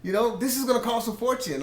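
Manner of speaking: a hammed-up furious rant at the wife; imagined angry yell. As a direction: let rip the scolding you held back. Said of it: "my flesh wanted to just scream at her".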